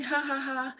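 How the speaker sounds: demonstrated pretend laughing; simulated laughter. Shown as a demonstration. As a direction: fake a light laugh on purpose. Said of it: "So we start laughing".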